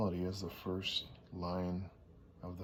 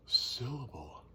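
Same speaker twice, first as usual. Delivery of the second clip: "syllable" with the first syllable SYL stressed and louder; 4 semitones higher, 4 dB louder, slower than usual. Shown as a demonstration. Teacher showing word stress on "syllable", stressed. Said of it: "You emphasize that first syllable".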